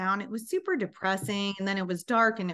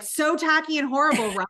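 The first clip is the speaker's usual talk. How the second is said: louder, higher self-mocking outburst; mock exasperated; 6 semitones higher, 5 dB louder than usual. To her mother-in-law, a playful louder blurt. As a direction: blurt it out as a joke.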